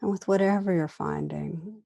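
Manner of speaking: calm meditation guide, long pauses between phrases; guided meditation. Real, not acted. Teacher inviting listeners to turn attention inward, guided meditation. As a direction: gently lead listeners inward, unhurried, leaving room after each phrase.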